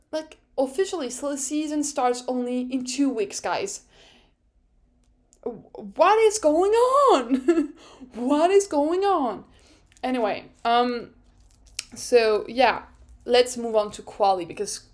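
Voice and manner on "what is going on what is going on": high, drawn-out incredulous cry; exasperated bewilderment. Real, not acted. Fan reacting to silly season, exasperated bewilderment. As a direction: wail it in bewilderment, slowly, half laughing. Exclaims bewilderment at driver rumours.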